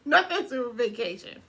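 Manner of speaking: laughing repeat of the joke. Real, not acted. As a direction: echo it while laughing. Repeats husband's line laughing.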